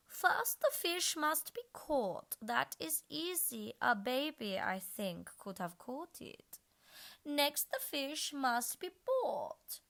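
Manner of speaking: high, slow sing-song recital. A narrator's reading. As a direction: recite the riddle playfully.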